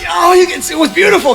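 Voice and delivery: normal talking voice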